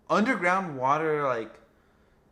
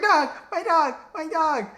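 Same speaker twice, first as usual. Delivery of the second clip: much higher, louder, wailing mock panic; 8 dB louder than usual. Friend panicking over his dead dog, imitated high wail. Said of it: "He's freaking out".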